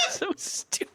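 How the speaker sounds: high, voiceless squeak through laughter; too tickled to get the words out; helpless laughter. Real, not acted. Podcaster cracking up at their own silly bit, helpless laughter.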